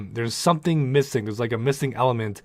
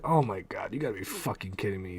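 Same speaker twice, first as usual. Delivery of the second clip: disbelieving mutter; 3 semitones lower, 8 dB quieter than usual. Retold or imitated. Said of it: "and I'm like".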